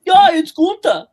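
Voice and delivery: very high, loud mock announcer shout; announcer call. Joining the announcer joke, loud mock announcer call. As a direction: bellow the name like a ring announcer.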